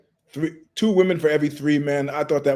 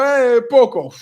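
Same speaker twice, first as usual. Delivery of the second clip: sheepish little Italian; 7 semitones higher, 7 dB louder, slower than usual. Real, not acted. Answering in Italian, modest.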